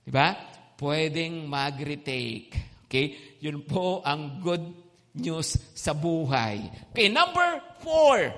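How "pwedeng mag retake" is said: normal talking voice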